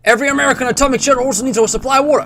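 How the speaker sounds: high, fast American announcer; hammed-up Cold War American voice; American accent. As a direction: rattle it off like American propaganda. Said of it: "like, an American voice".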